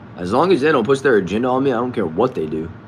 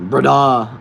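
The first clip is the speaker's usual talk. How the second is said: BRADA called out loud and higher; emphatic repeat; 4 semitones higher, 7 dB louder than usual. Real, not acted. Streamer savouring a viewer's slang word, emphatic repeat. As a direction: belt out "Brada" with a drawn-out last vowel.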